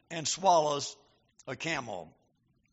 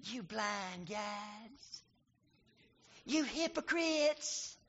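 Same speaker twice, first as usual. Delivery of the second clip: much softer, slow timid rebuke; meek mild rebuke; 9 dB quieter, much slower than usual. Shown as a demonstration. Preacher showing the wrong soft way Jesus spoke, meek and quiet. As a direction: say it meekly, barely above a breath. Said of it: "I can't picture him saying".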